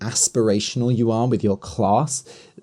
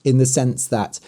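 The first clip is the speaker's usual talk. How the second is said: usual speaking voice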